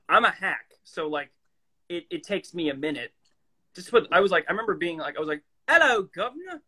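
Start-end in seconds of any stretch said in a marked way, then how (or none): 5.7-6.6 high, hammed-up British greeting; bad British accent. Actor recalling a clueless first try at British, bad British accent. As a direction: put on a cheesy mock-British accent. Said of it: "had no idea and bad British accent".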